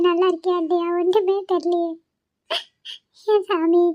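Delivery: put-on keen quietly for a missed child; tearful lament. Grandmother pining for granddaughter Kanmani, tearful lament. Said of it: "நீ எதுக்கு உட்கார்ந்து ஒப்பாரி வச்சிட்டு இருக்க".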